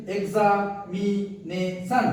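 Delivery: "Examination" slowly in four beats, এক্সা, মি, নে, শন; short gaps between the parts of "examination"; split into syllables. Shown as a demonstration. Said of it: "চারটি আছে এখানে".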